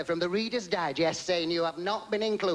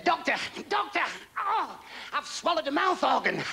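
panicked patient; 9 semitones higher than usual. Acted. Panicked patient calls doctor.

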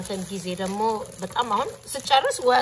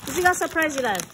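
ordinary talk

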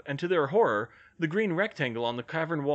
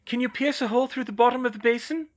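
put-on high, raised girlish pitch; high, projected female character voice; Hermione's voice; 12 semitones higher, 5 dB louder, faster than usual. A narrator's reading.

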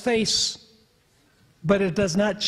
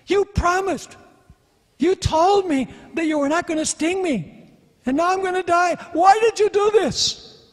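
dying frog's outcry; 9 semitones higher, 3 dB louder than usual. Retold or imitated. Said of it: "he says to the scorpion".

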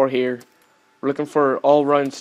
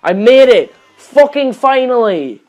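triumphant; 7 semitones higher, 8 dB louder than usual. Real, not acted. Finally reaching the goal.

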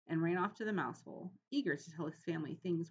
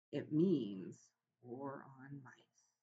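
very slow, low and dark warning; ominous; 4 semitones lower, much slower than usual. A narrator's reading. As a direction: draw it out like a grim prophecy. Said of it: "said Aunt Dorothy ominously".

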